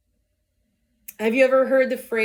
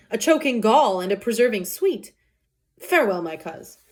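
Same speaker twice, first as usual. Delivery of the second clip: raised, declaiming Shakespeare reading; dramatic Romeo recital; 5 semitones higher than usual. Acted. Teacher performing Romeo's lament to class, impassioned. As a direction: deliver the oxymorons like a lovesick hero.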